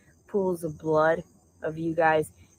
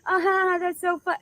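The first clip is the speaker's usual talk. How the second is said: mocking squeal; 14 semitones higher, 5 dB louder than usual. Mocking someone's whine.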